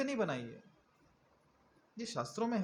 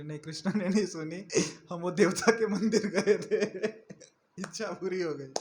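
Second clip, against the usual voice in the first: devotee boasting with a laugh; 4 dB louder than usual. Retold or imitated. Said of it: "बोले".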